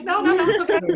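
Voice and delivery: high, loud laughing protest, half crying; brushing it off with a teary laugh; laughing through tears. Retold or imitated. Consoled by the mom, she laughs off her tears. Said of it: "I'm like".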